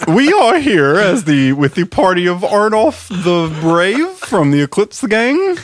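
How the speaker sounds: high, slow, silly elf voice; christmas elf. Acted. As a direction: put on a goofy elf voice. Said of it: "What does his elf voice sound like?".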